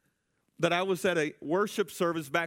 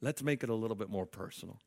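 hushed, intimate whisper to the church; 5 semitones lower, 6 dB quieter than usual. Real, not acted. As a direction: lean in and whisper it. Turns intimate in sermon.

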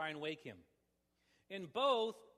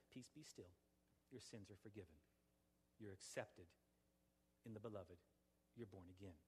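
gentle reassurance; 8 semitones lower, 14 dB quieter than usual. Acted. Voicing God's peace to forgiven heart.

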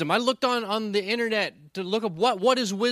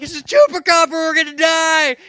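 very loud, high mock-terrified yell; hysterical panic; 10 semitones higher, 10 dB louder than usual. Retelling his warning to his friend mid-shooting, hysterical yell. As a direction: scream the warning in comic hysteria. Retells own terror in attack.

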